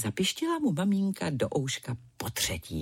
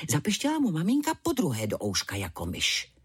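ordinary delivery

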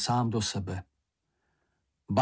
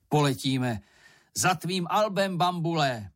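higher, rallying call; excited call; 4 semitones higher than usual. A narrator's reading. Šňupálek announces the flight to Bambul, excited call. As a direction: call out as if setting off. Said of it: "vykřikl Šňupálek".

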